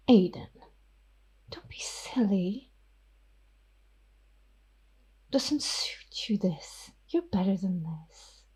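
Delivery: hushed, menacing vampire whisper; whispering vampire Ophelia. Acted. Ophelia the vampire threatens Miss York, whispering. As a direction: breathe the threat softly at her.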